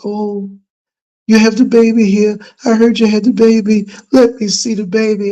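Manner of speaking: high, gushing neighbor voice, pitched well up; eager neighbor. Retold or imitated. Neighbor asking to see the baby, high and eager. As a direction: play the excited neighbor begging to see the newborn. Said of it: "and she says".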